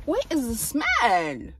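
usual speaking voice

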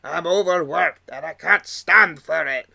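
lightly voiced sulky engine complaining; mock-grumbling engine quote; grumpy Duncan. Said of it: "there is a quote".

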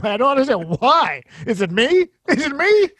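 mock-anguished plea, rising on each question; exasperated comic bit. Acting out his comedy bit.

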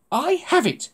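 the plan just clicked; excited exclamation. Acted. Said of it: "she exclaimed".

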